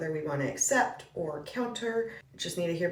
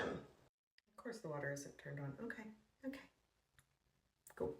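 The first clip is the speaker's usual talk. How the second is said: resigned aside; 3 semitones lower, 17 dB quieter than usual. Acted. Agent hearing the water is off, resigned aside. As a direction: say it under your breath. Exasperated aside on bad news.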